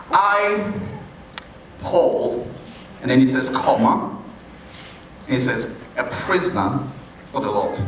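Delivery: comma pause after I, Paul. Shown as a demonstration. Preacher shows the comma pause after "Paul," to the congregation. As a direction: leave a clear break after "Paul,". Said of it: "It's interesting how he starts".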